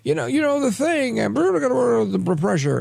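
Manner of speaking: high, fast, rambling mock old man; hammed-up imitation of a muddled speaker; mock Biden ramble. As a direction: rattle it off like a confused old politician.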